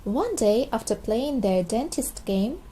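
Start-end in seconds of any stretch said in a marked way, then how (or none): none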